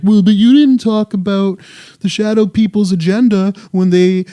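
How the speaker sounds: whiny complaining true believer; mock believer. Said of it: "diehard true believers".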